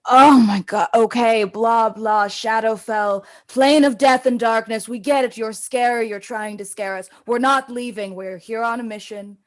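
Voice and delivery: loud exasperated rant; fed up. Acted. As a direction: vent like she's hit her limit. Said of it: "she's hit her freaking limit".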